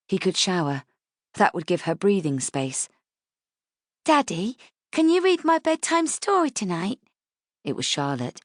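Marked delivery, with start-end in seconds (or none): 4.0-7.0 put-on high child's voice, eager; a small girl begging daddy for a story; little girl asking. Daughter asks for bedtime story.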